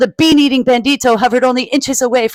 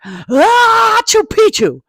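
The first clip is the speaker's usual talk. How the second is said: password blurted out as a big high sneeze; mock sneeze carrying a word; half sneezed; 13 semitones higher, 5 dB louder, much slower than usual. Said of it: "half sneezed, half spoke".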